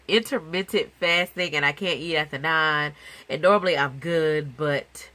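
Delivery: usual speaking voice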